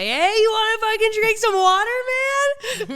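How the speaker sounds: high, loud dude-bro call; hammed-up bro offering water; mock bro voice. Bro voice to drunk boyfriend.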